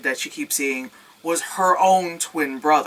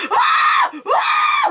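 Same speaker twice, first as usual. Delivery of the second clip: very high, loud shrieks; mock screaming; 4 dB louder than usual. The trapped heroine freaking out, very high mock shrieks.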